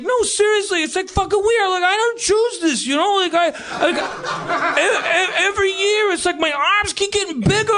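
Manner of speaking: high, pleading big guy; thick-accented boxer. Retold or imitated. Big guy confessing to his teasers, high plaintive protest. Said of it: "really thick, uh, accent".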